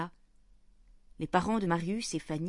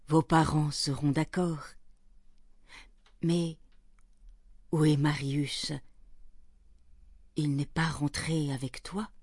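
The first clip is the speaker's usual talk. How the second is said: measured, slightly lowered grandfather voice; reassuring grandfather; 2 semitones lower than usual. A narrator's reading. Grandfather reassures granddaughter and asks after Marius, calm old man's voice. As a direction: speak as an unhurried grandfather.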